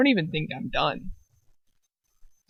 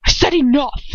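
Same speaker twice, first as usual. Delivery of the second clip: louder, higher furious order; angry shout; 7 semitones higher, 7 dB louder than usual. Acted. Lucius silencing Dolohov at dinner, angry shout. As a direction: bark it to shut them up. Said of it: "His voice raising and pulsing with anger".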